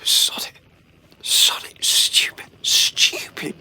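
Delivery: harsh whispered cursing at oneself; muttered self-reproach. Acted. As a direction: berate yourself under the breath. Cursing himself after she leaves.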